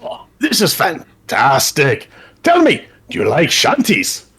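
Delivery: high, gleeful boat character; rally the crew with high-pitched glee; delighted talking boat. Acted. Boat invites the captain to sing shanties, delighted high voice.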